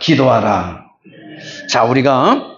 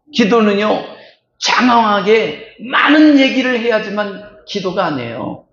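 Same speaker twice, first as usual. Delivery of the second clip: ordinary talk, nothing marked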